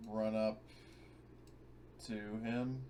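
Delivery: ordinary delivery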